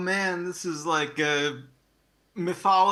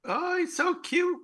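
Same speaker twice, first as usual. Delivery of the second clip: put-on much higher cooing baby talk; puppy voice; 13 semitones higher than usual. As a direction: gush at the puppy in a high coo. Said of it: "talking in that puppy voice".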